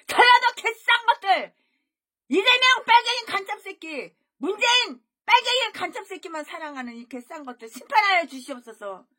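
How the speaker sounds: high, loud furious praying curse; cursing prayer. Retold or imitated. Preacher retells his cursing prayer at the meeting, loud and furious. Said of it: "나도 모르게 내 입에서".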